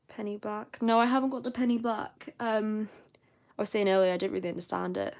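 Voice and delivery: normal talking voice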